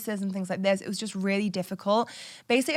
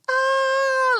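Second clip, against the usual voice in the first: high, loud squeal of joy, imitating the cleaner; mock a thrilled greeting; a happy cleaner's shriek on seeing her; delighted squeal; 6 dB louder than usual.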